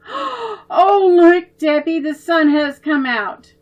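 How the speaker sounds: high, loud excited call; excited yell. Acted. Babs calling to Debbie about the sun, excited yell. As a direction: shout the good news to your sister. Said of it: "Babs yelled".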